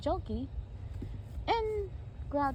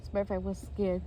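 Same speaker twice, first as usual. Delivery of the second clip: ordinary delivery, nothing marked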